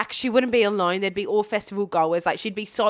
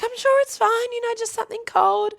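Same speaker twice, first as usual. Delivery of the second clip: much higher, sweet reassuring voice; high reassuring friend; 13 semitones higher than usual. Retold or imitated. Friend reassuring sick mate it's fine, in a high sweet voice. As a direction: chirp it like a friend smoothing things over. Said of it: "the other friend was like".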